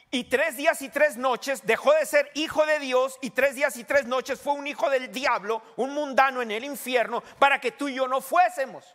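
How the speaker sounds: high, loud, driving sermon delivery; urgent, insistent preacher; full-force preaching. Real, not acted. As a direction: press every phrase on the congregation.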